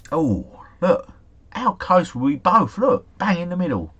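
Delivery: high, lifted voice of real delight; excited reaction. Real, not acted. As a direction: marvel at a near-perfect guess, pitch up. Sees both guesses landed very close.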